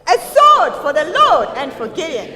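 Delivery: loud group chant; group battle cry. Real, not acted. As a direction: shout it together like an army. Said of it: "Can you say it with me?".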